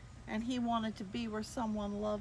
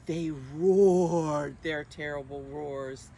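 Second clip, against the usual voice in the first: low, louder growl of a read-aloud roar; 4 semitones lower, 8 dB louder, slower than usual. A narrator's reading. Reading to children, a growly roar. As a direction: roar it out for the kids.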